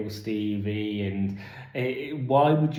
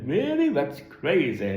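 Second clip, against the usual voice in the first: much higher, hammed-up airhead gasp of surprise; mock surprise; 6 semitones higher, 4 dB louder than usual. Reviewer mocking a survivor's shallow reaction to the news, hammed-up surprise. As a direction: overplay a shallow "wow" reaction.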